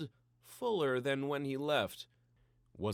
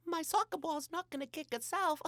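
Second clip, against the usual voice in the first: put-on much higher, child-like voice; Pam's excuse. A narrator's reading. Pam making an excuse to sneak away, in a much higher voice. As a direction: toss off the excuse lightly. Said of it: "laughed Pam".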